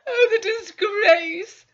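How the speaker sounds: high, loud, slow tearful wail; wife crying over the family shame; sobbing. Acted. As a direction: moan it through tears. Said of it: "Missus Carter began to sob".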